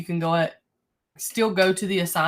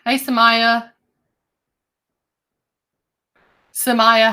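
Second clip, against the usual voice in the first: calling a name loudly; 5 semitones higher, 9 dB louder than usual. Real, not acted. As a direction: call her name to get attention. Teacher calling a student online.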